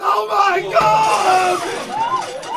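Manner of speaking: long high-pitched shriek; panicked scream. Acted. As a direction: scream at the sight of a mouse. Sees a mouse, screams.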